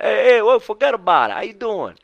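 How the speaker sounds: high, loud mock New York tough-guy accent; mocking Chris. Coach teasing client Chris in a hammed-up New York accent. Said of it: "I kinda make fun of him".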